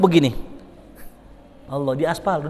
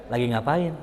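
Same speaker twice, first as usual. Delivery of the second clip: soft flirty ask; 4 semitones lower, 6 dB quieter than usual. Shown as a demonstration. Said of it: "Kan bisa begini".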